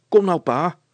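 put-on high, loud, upbeat boy; rally father cheerfully. A narrator's reading. Hannes encourages his worn-out father, cheerfully. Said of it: "zei Hannes opgewekt".

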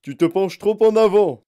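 urgent full-voiced shout; father's yelled warning. A narrator's reading. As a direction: bellow a warning to your son. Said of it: "hurla monsieur Gloop".

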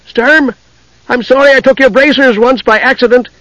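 high-pitched call after a friend. A narrator's reading. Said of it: "Tass called their names".

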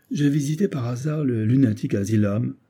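usual speaking voice

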